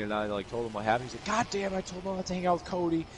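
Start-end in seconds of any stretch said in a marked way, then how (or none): 1.3-3.1 pissed-off dad. Retold or imitated. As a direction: mimic an irate dad cursing his son. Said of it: "just, like, pissed off".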